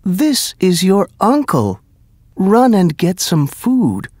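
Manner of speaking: high, eager mother; a hint of an excited mother; lightly voiced mother. A narrator's reading. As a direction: urge him on, delighted.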